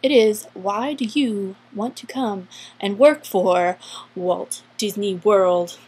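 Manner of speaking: normal talking voice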